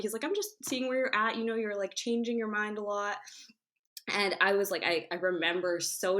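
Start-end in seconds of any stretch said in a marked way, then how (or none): 0.2-3.2 higher, gently apologetic dad; sweet dad. Retold or imitated. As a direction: soften it like a sweet, sorry father. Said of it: "he was being so sweet about it".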